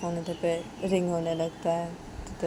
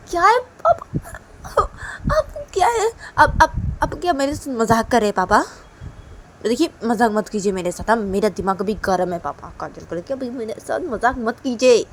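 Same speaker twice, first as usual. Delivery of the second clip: high, loud, exasperated scolding; angry daughter; 5 semitones higher, 12 dB louder than usual. Acted. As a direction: snap at papa to stop joking. Angry daughter scolds joking father. (गुस्से में ऊँची आवाज़ में डाँटना)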